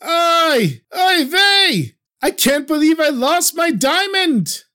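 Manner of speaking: high wailing oy vey, shouted; fake crying wail. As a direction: wail over the lost diamond, putting it on. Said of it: "And I started crying".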